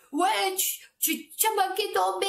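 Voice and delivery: high mock yell of a frantic mother; imitating a yell. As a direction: mimic the mother screaming at her child. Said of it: "elle hurlait". (imitation d'une mère qui hurle sur son enfant, voix haute)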